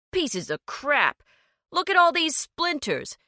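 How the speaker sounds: whiny annoyed complaint; complaining. A narrator's reading. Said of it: "Gary complained".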